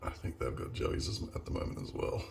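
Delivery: whisper. Real, not acted. Quiet aside while filming wildlife.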